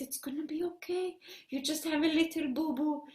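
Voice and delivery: very soft, quick reassurance; soothing. Acted. Hannah kisses Noor's knee, hushed soothing. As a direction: comfort a small child in a hushed voice.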